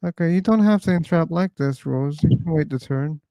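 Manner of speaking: normal talking voice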